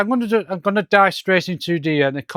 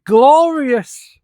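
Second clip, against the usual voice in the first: much higher, louder, stretched exclamation; hammed-up delight; 9 semitones higher, 5 dB louder than usual. Reviewer voicing a delighted Eggman, hammed-up exultation. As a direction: exult like a cartoon villain.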